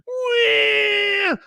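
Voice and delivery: playful high-pitched whoop; gleeful squeal. Pretend. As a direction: let out a giddy little cheer. Playful exclamation on stream.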